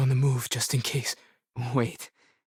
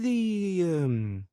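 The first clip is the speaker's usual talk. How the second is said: hesitant stammer, slow start; groping for words; 5 semitones lower, 3 dB quieter, slower than usual. Acted. Asking after a lost thing, groping for words. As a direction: trail off, then stumble after the missing word.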